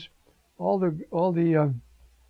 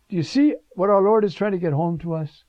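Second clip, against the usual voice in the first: ordinary talk, nothing marked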